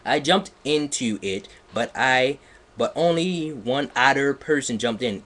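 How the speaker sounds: ordinary talk, nothing marked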